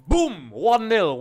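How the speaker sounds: high, loud celebratory burst; celebrating a goal. Real, not acted. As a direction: punch out the score in triumph.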